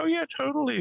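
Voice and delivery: high, dismissive mock agreement; sleepy wife. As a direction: brush it off, half asleep. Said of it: "She's like".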